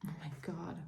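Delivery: breathy exasperated whisper. Real, not acted. Reader calming down after a rant, spent whisper. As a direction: breathe it out, drained.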